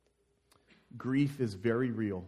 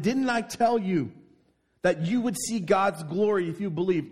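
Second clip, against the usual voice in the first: angry rebuke; 7 semitones higher, 6 dB louder than usual. Acted. Jesus rebuking Martha, loud angry rebuke. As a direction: press it with anger. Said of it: "he's angry".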